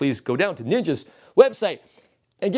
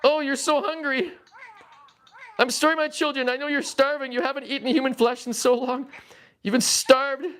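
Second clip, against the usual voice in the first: mock anguish; 12 semitones higher than usual. Streamer comforts trapped, starving Furby children, mock-anguished wail. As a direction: lament like a hammy tragic parent. Playing anguished parent to Furbies.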